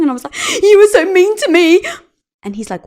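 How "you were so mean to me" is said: high, loud mock wail; retold tearful complaint to her husband, mock wail; imitating teary whine. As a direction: whine it as if about to burst into tears. First time I'm crying at work.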